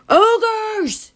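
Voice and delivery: yelled warning of danger; coachman's yell. Acted. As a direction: shout the warning at the horses. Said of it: "the coachman yelled".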